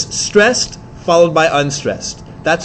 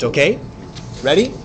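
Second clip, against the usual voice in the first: normal talking voice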